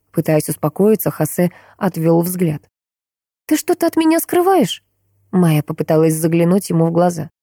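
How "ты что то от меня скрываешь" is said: much higher, probing daughter's voice; suspicious daughter. A narrator's reading. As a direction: lift the pitch sharply into a suspicious question. Daughter suspects father hides something.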